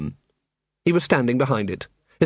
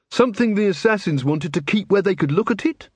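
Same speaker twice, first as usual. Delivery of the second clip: high, questioning guess; lighter-pitched constable, rising query; Angua, tentative constable; 6 semitones higher than usual. Acted. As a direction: venture a guess in a higher voice.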